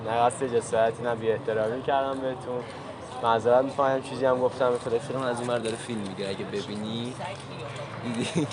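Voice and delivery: softly confessing the hidden camera prank to the woman; soft apologetic reveal. Real, not acted. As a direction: drop the act and apologise quietly.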